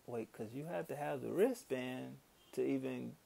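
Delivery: normal talking voice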